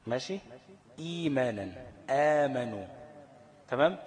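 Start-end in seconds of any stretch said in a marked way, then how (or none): 1.0-1.9 "إيمانًا" with the first ee held two beats; no catch, lengthened i in "إيمانًا"; two-count long i. Shown as a demonstration. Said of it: "لازم أمد حركتين". 2.1-2.9 "آمنوا" with a louder, held aa; long smooth alif in "آمنوا"; two-count long a. Shown as a demonstration. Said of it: "لازم أمد حركتين".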